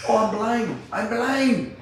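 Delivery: high, loud hammed-up stoner; high mock stoner whine. Stoner recoiling from the cop, high whine.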